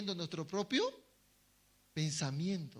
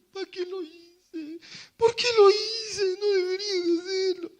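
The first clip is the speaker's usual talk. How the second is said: acted crying; 10 semitones higher, 8 dB louder than usual. Preacher acting a sinner crying over his sin, high loud wailing sob. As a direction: cry out in regret. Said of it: "Está llorando".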